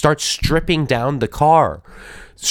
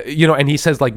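ordinary talk, nothing marked

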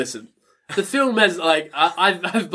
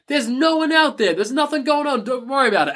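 higher, breezy brush-off; mock dismissive; 8 semitones higher than usual. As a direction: wave off the danger carelessly. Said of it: "Trent's like".

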